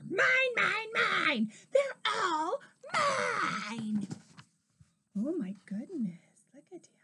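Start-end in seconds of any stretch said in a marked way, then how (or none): 0.1-4.1 high, drawn-out dinosaur roar; loud, high possessive roar. A narrator's reading. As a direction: roar greedily, dragging out the last word. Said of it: "and then he roared".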